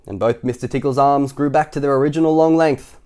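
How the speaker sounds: usual speaking voice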